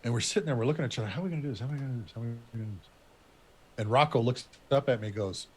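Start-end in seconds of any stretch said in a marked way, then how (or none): 1.1-2.9 quick, quiet, repeated muttering; hushed mock bewilderment, rattled off; mimicking a stumped huddle. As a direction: run it together under your breath, like puzzled teammates. Mimics puzzled huddle, repeating question.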